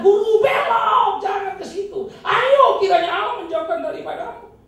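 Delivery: slow, high, loud pleading cry; Peter's plea. Acted. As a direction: beg him to turn back, at full voice. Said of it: "Itu sebab dia kata".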